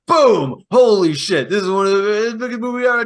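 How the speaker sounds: loud mock excitement; mock excited shout; mock hype. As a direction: hype up the first hit. First take of the hype.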